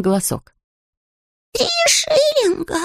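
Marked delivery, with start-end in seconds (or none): 1.5-2.8 high, slow little bear's lament; woeful cry. Acted. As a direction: wail it in dismay, high. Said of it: "горестно восклицал он".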